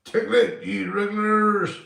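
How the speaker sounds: high, loud call-out; hyped shout. Real, not acted. Streamer to his regulars, hyped shout. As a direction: holler it out to the crowd.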